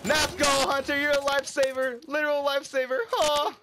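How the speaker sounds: high, loud hype shout; elated cheering. Real, not acted. Gamer to teammate who saved his life, elated cheering. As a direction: cheer your rescuer at the top of your pitch.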